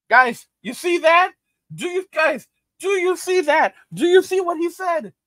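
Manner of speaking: high excited see that; excited call-out. Real, not acted. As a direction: point at it, worked up. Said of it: "pointing out the comment".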